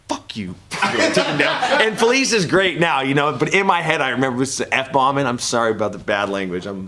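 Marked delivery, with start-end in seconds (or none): 0.1-0.5 softly muttered retold curse; muted retort. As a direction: say it under your breath. Retold swear under his breath.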